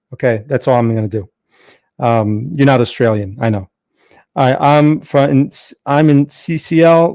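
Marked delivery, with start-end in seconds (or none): none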